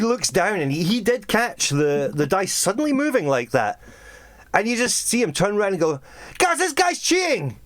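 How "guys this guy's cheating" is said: high-pitched alarmed call to the guards; outraged patron, voice jumping up high; calling out in accusation. Acted. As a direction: cry it out to summon help. Patron calls guards on cheater.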